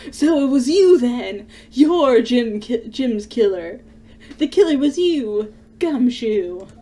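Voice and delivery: nail the culprit loudly; loud cry of accusation; triumphant accusation. Acted. Accuses Gumshoe of murder.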